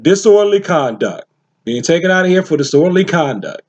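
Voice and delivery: ordinary delivery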